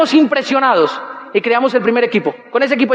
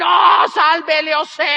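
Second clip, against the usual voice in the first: mock reverent hail, drawn out slowly; worshipping crowd; 3 semitones higher, much slower than usual. Whole audience hailing a soap product, mock worship. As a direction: hail it like cult followers. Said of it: "todo el mundo iba a decir".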